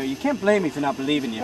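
normal talking voice

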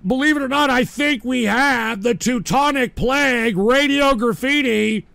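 big radio-host build-up; show announcer. Real, not acted. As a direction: announce the next caller, high and loud. Host announces the next caller.

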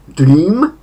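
dream with a long ee, high and rising. Shown as a demonstration. Teacher models the irregular verb's first form, long-vowelled dream. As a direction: stretch the ee in dream, lifting it. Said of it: "wydłużone i".

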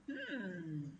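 ordinary delivery

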